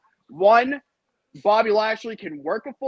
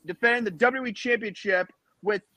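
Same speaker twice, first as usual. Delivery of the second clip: ordinary talk, nothing marked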